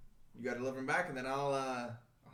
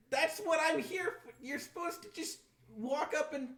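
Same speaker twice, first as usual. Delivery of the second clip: exasperated; 11 semitones higher than usual. Acted. GM character keeps complaining.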